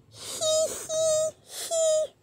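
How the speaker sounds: squeaky soft tittering giggle; high giggle. Acted. Book giggling at the reader's funny word. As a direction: giggle like a tickled little book.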